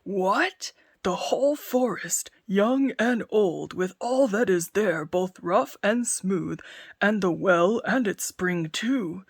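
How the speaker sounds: slow, loud growl into his beard; growling giant. Acted. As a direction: grumble in alarm. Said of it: "growled the credulous giant".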